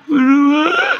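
drawn-out, whimpering mock answer; mock pitiful. Spouse tearfully claims the partner has changed, pitiful. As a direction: plead it out pitifully, badly faking tears. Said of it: "Ini berubahnya sambil melas gitu".

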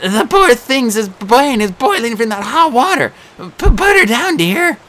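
coaxing the monster in panic; nervous satyr bride. A narrator's reading. Grover playing the bride.

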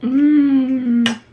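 loud satisfied hum; savoring mmm. Real, not acted. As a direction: hum with pleasure at the taste. Savoring food.

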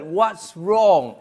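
loud call across a busy highway; shouted across highway. Retold or imitated. Truck driver shouting across the highway, loud call. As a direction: shout it over the traffic. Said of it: "he shouted".

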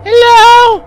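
very high, sing-out greeting; high-pitched hello, drawn out; high calling-out. Pretend. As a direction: call out timidly high to a stranger. Calls to game character.

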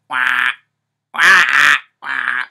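low loud quacking wah; duck quack. Shown as a demonstration. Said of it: "Can you make a duck noise?".

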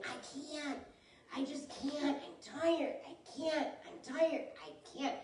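high-pitched whine, 'I can't, I'm tired'; whiny tired complaining. Retold or imitated. Imitating a weary believer who keeps saying they can't, high mock whine. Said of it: "if you hear yourself saying".

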